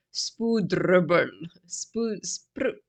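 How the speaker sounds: spoedrubbel in a proper Afrikaans accent. Shown as a demonstration. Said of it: "with a little bit of a, you know, a proper Afrikaans ac-, accent".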